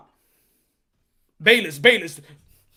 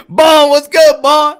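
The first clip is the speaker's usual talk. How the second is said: much louder, higher greeting; loud shout-out; 7 semitones higher, 17 dB louder, slower than usual. Real, not acted. Streamer greeting a viewer named in chat, loud shout-out. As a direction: hail him across the room.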